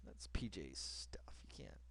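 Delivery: low confiding whisper; hushed aside to the audience. Real, not acted. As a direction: lean in and whisper it like a secret.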